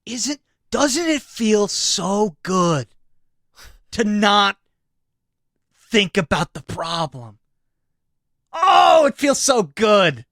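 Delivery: hammed-up rapture, drawn out and swelling; over-the-top relish. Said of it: "Are you okay right now?".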